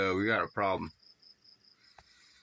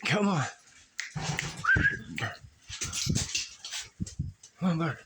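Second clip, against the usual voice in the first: high coaxing call to a bird; sing-song call, urging the bird; coaxing a wild bird; 8 semitones higher, faster than usual. Real, not acted.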